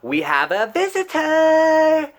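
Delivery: high, loud sing-song shout; high yelled mock announcement; shrill tattling call.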